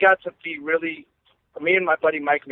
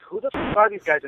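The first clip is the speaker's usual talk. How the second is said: higher, amused who-are-these-guys voice; mock disbelief; 6 semitones higher than usual. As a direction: imitate the players' baffled laugh-line. Players puzzled by superfans.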